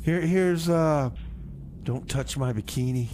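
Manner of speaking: normal talking voice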